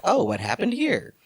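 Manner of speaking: high-pitched, oblivious "oh"; mock surprise. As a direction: play clueless surprise, pitched well up. Acting out the returning men's reaction.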